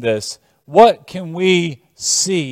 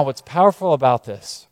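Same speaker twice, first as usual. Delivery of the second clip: ordinary talk, nothing marked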